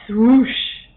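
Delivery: swoosh punched out loud. A narrator's reading. Narrator sounding the shadow dashing past. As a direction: whoosh it like something rushing past.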